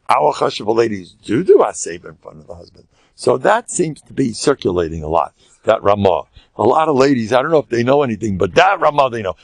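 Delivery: usual speaking voice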